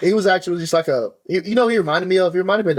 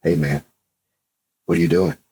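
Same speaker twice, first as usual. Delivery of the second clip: a lot lower and soft-spoken; soft creepy voice; 10 semitones lower than usual. Retold or imitated. Imitating the quiet stranger at the convention, low and soft. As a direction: murmur it low, like a harmless-looking creep. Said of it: "he had a real soft voice like this".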